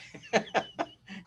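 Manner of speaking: breathy laugh. Real, not acted. Laughs mid-sentence.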